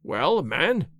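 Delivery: mildly defiant, rising challenge; grudging challenge with a touch of defiance. A narrator's reading. Householder to youth after another pause, defiantly. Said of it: "and then defiantly".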